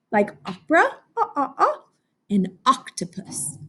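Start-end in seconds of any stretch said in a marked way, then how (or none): 1.2-1.8 "ah, ah, ah" in short, high bursts; short O repeated. Shown as a demonstration. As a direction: sound out short O high, three times. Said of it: "those are its two sounds".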